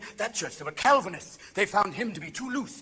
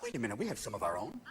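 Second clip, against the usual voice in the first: low, soft, quiet aside; hushed realization; 11 semitones lower, 13 dB quieter than usual. Acted. Officials realizing they have their own killer, low and soft. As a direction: murmur it low as a dawning thought. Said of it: "and went".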